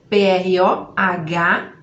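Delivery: P R O H spelled slowly, each letter apart. Shown as a demonstration. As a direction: spell the letters one at a time. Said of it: "É assim que a gente vai escrever".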